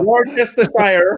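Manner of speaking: slow "Worcestershire" with all the letters said; mock American accent. Shown as a demonstration. Friend copying the American "Worcestershire" accent. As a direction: drag "Worcestershire" out like a tourist. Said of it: "Wor-, Worcestershire".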